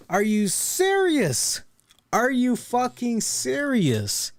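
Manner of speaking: high, drawn-out disbelief; slow, high-pitched incredulous outburst. Real, not acted. Mock disbelief at wrestler's accent switch.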